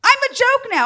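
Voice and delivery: high, loud wounded cry; mock-wounded yell; offended outburst. Real, not acted. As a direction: protest the insult.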